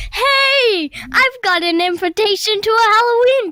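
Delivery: high, loud announcement; Charlie Brown. Acted. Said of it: "is where Charlie Brown goes like this".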